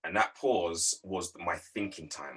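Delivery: ordinary talk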